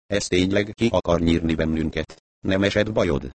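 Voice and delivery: ordinary delivery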